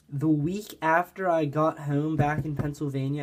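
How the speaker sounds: ordinary talk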